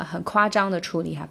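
usual speaking voice

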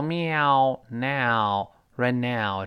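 "now" drawn out slowly like "meow"; like a cat's meow. Shown as a demonstration. Teacher likening "now" to a cat's cry, meowed. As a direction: stretch "now" into a slow meowing glide. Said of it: "就跟猫叫".

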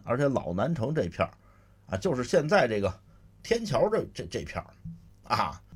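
ordinary delivery, nothing marked

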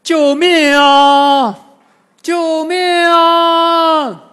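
long, high, slow drawn-out cries for help; calling for help. A narrator's reading. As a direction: howl for help across the road. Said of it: "大声呼喊".

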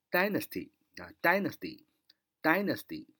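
slow dynasty, weight on DY; stress on the first syllable. Shown as a demonstration. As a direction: say dynasty slowly, hitting DY hard. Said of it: "最重音呢在最前面啊". (慢读 dynasty，重音落在第一个音节 DY 上)

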